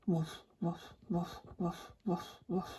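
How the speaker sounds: low, soft pretend woofs; dog barking. Thirsty dog woofs beside its owner, soft low pretend barking. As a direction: imitate a dog with small, low barks. Voices the dog wanting more water.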